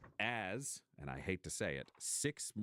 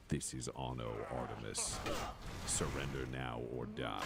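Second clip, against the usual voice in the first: battlesuit pilot's voice; 6 semitones lower than usual. Acted. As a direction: deliver a low threat from inside the war machine. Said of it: "you hear a voice from within it say".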